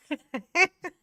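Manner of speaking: high burst of laughter; laughing. Real, not acted. Laughing at own impression of Alicia. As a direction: crack up laughing.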